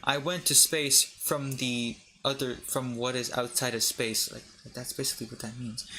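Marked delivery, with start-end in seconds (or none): none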